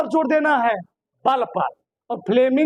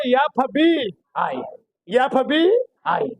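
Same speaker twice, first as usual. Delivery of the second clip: FBI spelled out very slowly; drawn-out FBI; much slower than usual. Shown as a demonstration. Teacher drilling class on the FBI rule, very slowly. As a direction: stretch each letter of FBI. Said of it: "इसको हम लोग क्या बोलेंगे".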